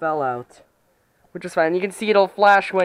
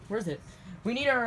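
usual speaking voice

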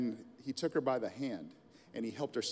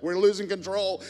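mock panic; 6 semitones higher, 8 dB louder than usual. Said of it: "They're like".

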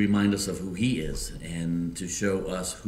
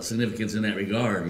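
ordinary delivery

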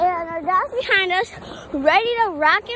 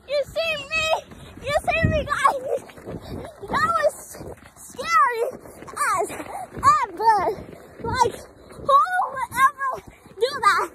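excited; 6 semitones higher than usual. Real, not acted. Thrilled after sledding down.

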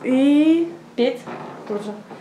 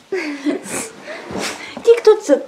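squeaky praising a dog; 6 semitones higher, much slower than usual. Real, not acted. Cooing at the dog.

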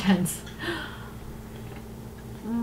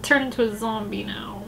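much softer, sinking "oh no"; dismayed; 11 dB quieter than usual. Real, not acted. Watching a boy turn zombie, soft and dismayed. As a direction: say it under your breath in dismay.

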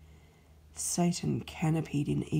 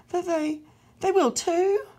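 put-on much higher, louder, petulant child; childish retort; 12 semitones higher, 7 dB louder than usual. A narrator's reading. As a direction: whine it back like a sulking kid. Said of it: "His retort was childish".

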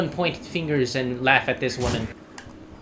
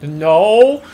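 sharp loud cry of protest; 4 semitones higher, 10 dB louder than usual. Real, not acted. Player shouting at the game for dumping him outside the map, loud protest. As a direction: yell it out in disbelief.

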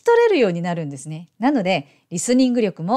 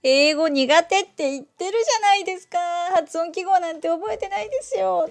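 high, whining mock protest; complaining viewer; 8 semitones higher than usual. Teacher voicing viewers who dread phonetic symbols, high mock complaint.

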